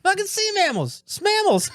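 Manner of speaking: squeaky high-pitched voice. Acted. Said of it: "the voice changey stuff".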